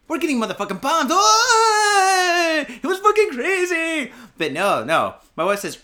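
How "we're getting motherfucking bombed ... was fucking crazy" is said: high, loud, frantic yelling; losing it in the street during the quake; panicked yell. Retold or imitated. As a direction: scream it like bombs are falling. Panicked retold reaction, yelling.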